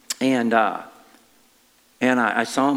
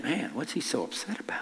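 hushed, breathy aside to himself; whispered thought; 3 semitones higher, 10 dB quieter than usual. Retold or imitated. Preacher recalls his own puzzled thought, whispered.

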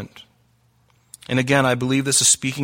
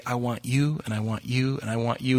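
softer tender plea; 2 semitones lower, 7 dB quieter than usual. Pretend. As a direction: gently say it to each listener. God saying he wants you.

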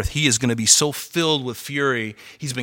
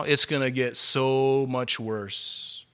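slow grave warning; 4 dB quieter, much slower than usual. Real, not acted.

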